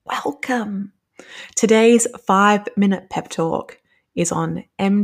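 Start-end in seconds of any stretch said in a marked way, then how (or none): 0.1-0.9 breathy whisper of the word; whispered welcome. Pretend. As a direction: whisper it like a secret.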